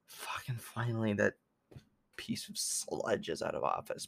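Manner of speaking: breathy unvoiced relieved whisper. Real, not acted. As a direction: hush it out with relief.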